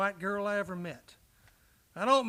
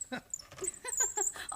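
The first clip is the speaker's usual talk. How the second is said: hearty laugh; 10 semitones higher, 7 dB quieter than usual. Acted. Husband laughing at wife.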